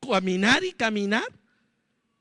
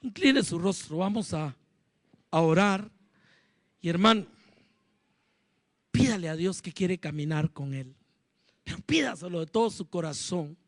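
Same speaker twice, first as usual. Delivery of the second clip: leading prayer; 3 semitones lower than usual. Real, not acted. Pastor guiding the congregation in morning prayer, hushed. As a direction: gently lead them into prayer.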